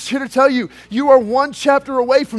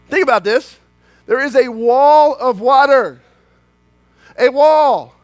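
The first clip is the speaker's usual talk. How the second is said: slow, louder preaching; preaching emphasis; 2 semitones higher, 4 dB louder, slower than usual. Acted. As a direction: hammer home the wall of water. Preaching climax.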